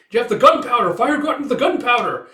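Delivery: high, loud cry of alarm; retold battle warning, urgently called; alarmed shout. Rider warns that fire reached the gunpowder, alarmed shout.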